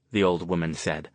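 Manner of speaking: ordinary delivery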